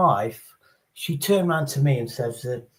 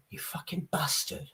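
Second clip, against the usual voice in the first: hushed, whispered venom; aggressive swearing; 13 dB quieter than usual. Shown as a demonstration. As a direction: whisper the insult as a threat. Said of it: "there's a way of saying it".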